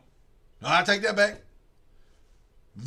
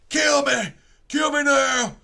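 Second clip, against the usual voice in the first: yelled plea; 7 semitones higher, 5 dB louder, slower than usual. Retold or imitated. Cornered villain begging to die.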